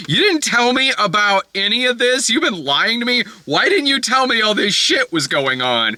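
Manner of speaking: high, indignant mock scolding; pissed off. Wife confronts husband about his lying, pissed off. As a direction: imitate a wife laying into her husband. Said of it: "Regina Hall's pissed".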